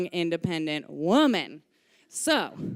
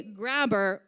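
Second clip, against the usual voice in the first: usual speaking voice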